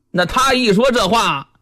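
ordinary delivery

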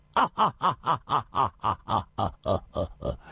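long, booming hearty laugh; unrestrained laughter. A narrator's reading. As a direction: throw your head back and roar with laughter. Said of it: "李寻欢纵声大笑道". (纵声大笑)

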